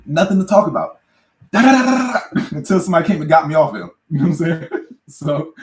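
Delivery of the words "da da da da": loud, high da da da for punches; mock fight noises. Telling how he fought the kid, loud mock punch sounds. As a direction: hammer out the blows as sounds.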